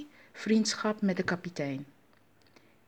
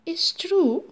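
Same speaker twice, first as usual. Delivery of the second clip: high, loud astonished question; hammed-up surprise; surprised; 11 semitones higher, 5 dB louder than usual. A narrator's reading. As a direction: ask in amazement, pitch leaping up. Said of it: "vroeg kapitein Anansi verrast".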